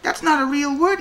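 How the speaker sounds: excited refusal; loud objection. Retold or imitated. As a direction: shut the word down with repeated no. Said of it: "I'm saying, like".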